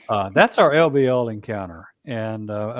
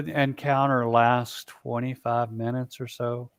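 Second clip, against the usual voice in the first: ordinary delivery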